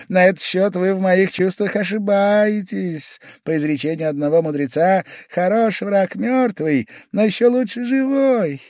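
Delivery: high, sly, needling little man; a spiteful clerk savouring his quip; Pavel Pavlovich voice. A narrator's reading. Pavel Pavlovich quoting a sage to needle Velchaninov, high-pitched.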